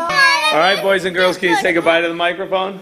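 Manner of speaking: bright, high sing-song host voice, fast. Real, not acted. Host wraps up with the kids.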